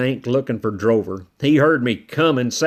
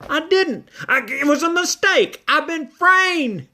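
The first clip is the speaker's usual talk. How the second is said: pleading high voice; 13 semitones higher than usual. A narrator's reading. Accused character pleads innocence.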